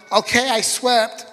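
put-on high, loud, slow kid's report; child voice. Small child telling a parent about a chore, high and proud. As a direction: play a proud little kid.

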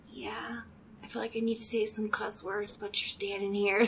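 hushed, strained whisper through pain. Retold or imitated. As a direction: whisper it so the kids barely hear. Hurt mom holding back cuss words.

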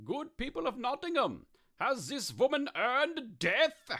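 put-on German-accented preacher addressing a crowd. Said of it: "Is that a German accent?".

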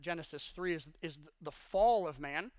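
ordinary delivery

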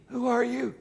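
very high-pitched, frightened question; startled high voice. Acted. Preacher as fallen Saul questioning the voice, startled high pitch. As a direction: squeak it up at the voice.

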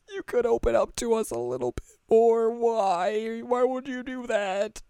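high, hurt pleading; high pleading girl. Retold or imitated. Voicing Kagome pleading.

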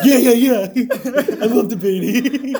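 high, loud gushing eagerness; mock excited impression. Host imitating his co-host lighting up over debating, mock excited. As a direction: tease with over-keen enthusiasm, breaking into laughter. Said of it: "like, lights up".

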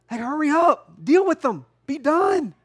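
high and loud, urgent plea; a loud, high plea to hurry it up; eager cry. Retold or imitated. Preacher mimicking eager Paul.

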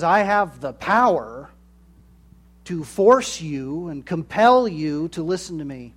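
loud, forceful commanding voice; a domineering boss demanding obedience; authoritarian voice. Shown as a demonstration. Preacher demonstrates an authoritarian demanding voice. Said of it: "An authoritarian voice says".

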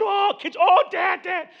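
high-pitched mock-frightened children; acted backseat panic; panicked kids. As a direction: imitate kids wailing for Dad. Acting kids panicking in car.

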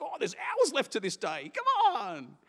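hammed-up night-owl eagerness; mock eager self. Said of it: "It's like".

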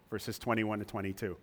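ordinary talk

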